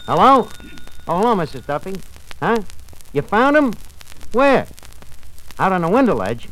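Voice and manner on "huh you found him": ordinary talk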